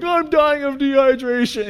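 high, hammed-up wail; mock an overdramatic teenager in agony; really dramatic. Stranded kids to their leader, melodramatic whining. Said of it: "they're being really dramatic".

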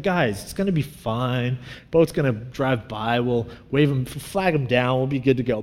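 low, soft put-on composure; calm and collected. Youth pastor to panicking students, calm and collected. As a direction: reassure them, keeping your voice down. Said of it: "trying to be calm and collected".

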